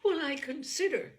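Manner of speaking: high, loud, anguished plea; high and loud in agitation; agitated. A narrator's reading. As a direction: cry it out, nearly breaking. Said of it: "in a yet more agitated voice".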